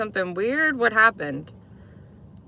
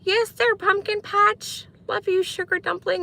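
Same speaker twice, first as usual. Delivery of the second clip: high, slow mock pet-name cooing; mock lovey-dovey cooing; sugary sweet talk; 12 semitones higher than usual. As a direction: drag it out like a sappy couple. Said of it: "talking to each other like".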